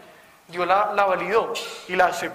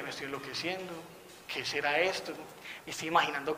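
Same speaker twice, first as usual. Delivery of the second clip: muttered to himself, much softer and lower; wondering aloud if he's losing it, under the breath; hushed self-doubt; 4 semitones lower, 10 dB quieter than usual. Retold or imitated. Retold inner doubt after strange word.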